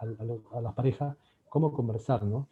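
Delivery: ordinary talk, nothing marked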